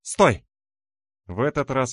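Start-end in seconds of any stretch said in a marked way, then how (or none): 0.0-0.5 высокий громкий выкрик; резко крикни, останавливая; громкий окрик. A narrator's reading. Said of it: "слыша в ответ громкое". (громкий повелительный окрик)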